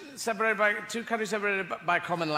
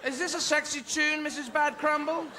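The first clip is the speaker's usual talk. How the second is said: put-on higher, doubtful child voice; young music pupil; 5 semitones higher than usual. Pupil doubting Missus Bad Crumble's tune, doubtful. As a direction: query it politely in a high voice.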